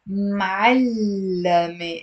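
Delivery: "ma'allame" slowly, with a long held double l; drawn-out "ma'allame" in three beats; three syllables. Shown as a demonstration. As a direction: say "ma'allame" as m'al-la-me, stretching the l. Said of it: "It's three syllables".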